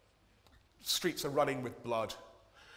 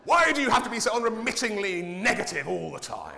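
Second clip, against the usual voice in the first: mock complainer; 9 semitones higher, 11 dB louder than usual. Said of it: "sent me another email".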